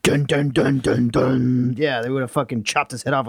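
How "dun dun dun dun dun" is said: whispered dramatic sting. Pretend.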